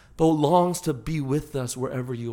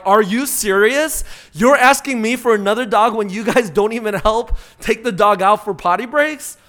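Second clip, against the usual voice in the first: exasperated parent; 5 semitones higher, 6 dB louder than usual. Retold or imitated. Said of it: "And I'm like".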